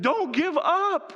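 high, drawn-out preacher's plea; urgent exhortation. Retold or imitated. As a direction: call out slowly and insistently. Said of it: "And I've said".

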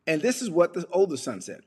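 normal talking voice

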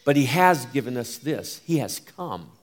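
ordinary delivery

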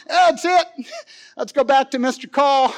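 half-laughing, loud and high; laughing mock shout of giving up; laughing mock exasperation. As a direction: throw up your hands, laughing. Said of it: "I'm like".

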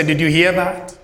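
usual speaking voice

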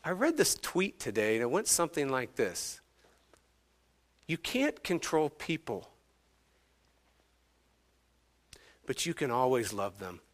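gentle, careful wifely advice; nicely, gently. Retold or imitated. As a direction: start high and soft, then settle tenderly. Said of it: "she very nicely just says".

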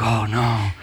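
hammed-up despair, rising to a near shout at the verse; mock panic. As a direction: act out a believer sure they are damned. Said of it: "it can even beat you up".

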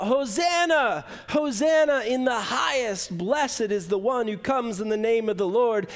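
sermon echoing the crowd's glad cry; crowd's hosanna. Retold or imitated. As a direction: ring it out like the cheering crowd. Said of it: "the people, they shout".

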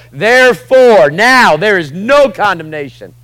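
therefore shouted high, slow and loud; proclaimed verse. Shown as a demonstration. Preacher shouting the verse to a condemned believer. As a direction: ring it out like a proclamation. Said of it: "I take them to Romans eight and I go".

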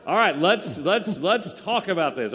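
high laughing through the words; laughing hard while talking. Real, not acted. Laughing at panel joke.

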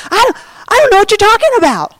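high, quick protest; Peter's denial. Acted. Peter denying Jesus before everyone, quick high protest. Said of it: "he denied it before anyone".

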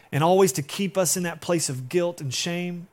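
ordinary talk

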